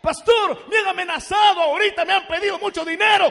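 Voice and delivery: high, loud desperate begging; panicked plea. Retold or imitated. Threatened church sister begging the pastor for money, panicked. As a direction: plead loudly, in a rush of fear. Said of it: "me dijo una hermana".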